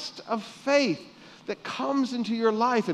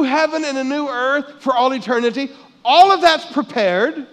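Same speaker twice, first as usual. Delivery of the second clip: raised, louder preacher's voice; emphatic preaching; 3 semitones higher, 7 dB louder than usual. Real, not acted. Preacher telling congregation what is prepared for eternity, emphatic preaching. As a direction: preach it out with rising force.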